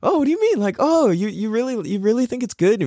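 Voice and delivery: high, coy feigned surprise; mock modesty. Humble cook brushing off praise, high coy voice. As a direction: play dumb about your own cooking, high and coy.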